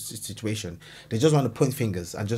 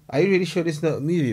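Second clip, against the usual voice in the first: disbelief; 4 semitones higher, 3 dB louder, much faster than usual. Real, not acted. Repeats faster.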